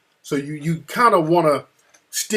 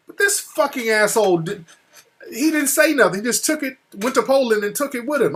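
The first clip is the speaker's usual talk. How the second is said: indignant venting; 7 semitones higher than usual. Real, not acted. Venting about editor taking film.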